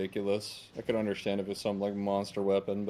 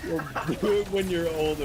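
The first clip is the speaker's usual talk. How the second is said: mocking echo; 8 semitones higher, 5 dB louder than usual. Friend mockingly echoes his line.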